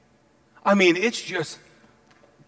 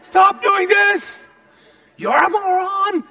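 high, drawn-out holler; yelling from the well; 12 semitones higher, 7 dB louder, much slower than usual. Acted. Prophet stuck down in the well to the king, long high yell. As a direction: call up loudly, stretching every word. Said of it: "in the well going".

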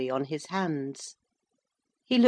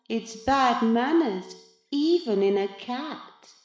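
put-on smug cat in boy's body; 8 semitones higher, 3 dB louder than usual. A narrator's reading. The new Maurice threatens his poor cat, gloating. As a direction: relish your new power over him, condescendingly.

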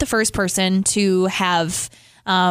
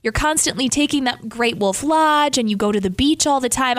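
ordinary delivery

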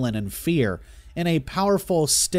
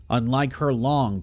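plain reading voice

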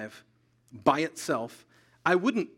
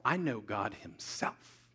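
lower, slow and self-assured; a hint of smug independence, said lower; proud self-reliant voice; 7 semitones lower, 6 dB quieter, slower than usual. Preacher voicing a proud believer, low and slow. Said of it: "It talks like this".

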